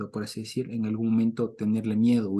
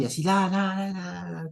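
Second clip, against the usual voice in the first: raised voice like a preacher; loud preaching demo; 8 semitones higher than usual. Shown as a demonstration. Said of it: "Si yo comienzo a alzar la voz". (predicador exaltado)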